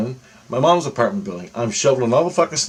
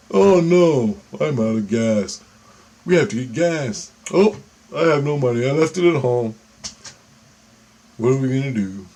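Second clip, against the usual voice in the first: mock dismay. Cousin pretending he's stranded without gas, mock dismay. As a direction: feign helplessness, playing it up slightly.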